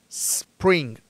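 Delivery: "spring" with a long hissed S and no E before it; snake-like S. Shown as a demonstration. Teacher repeating for learners, "spring" opened with a snake hiss. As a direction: hold the S, then "pring" for "spring". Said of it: "con el sonido ese de la serpiente".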